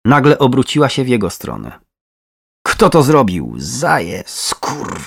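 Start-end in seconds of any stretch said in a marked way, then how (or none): 2.7-5.0 snarled question breaking into curses; angry, chopped-off swearing; growled. A narrator's reading. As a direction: growl the accusation, spit the curses. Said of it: "warknęła".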